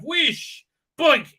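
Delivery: high cartoon whoosh and boink; comic high-pitched effect noises; knife sound effects. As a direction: imitate a knife flying and landing. Imitates thrown knife hitting.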